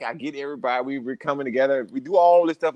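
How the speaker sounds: normal talking voice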